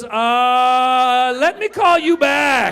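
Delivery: high, loud, drawn-out panic; hammed-up flustered booker, slow and shouted; alarmed stalling. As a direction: stretch it out in mock alarm. Alarmed booker ending the call.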